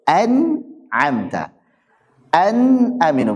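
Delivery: an'amta, an-aminu with a clear, slow nun; correct izhar. Shown as a demonstration. Teacher showing students the right reading, slow and clear. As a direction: spell out the nun in an-aminu, unhurried. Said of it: "Yang paling cocok".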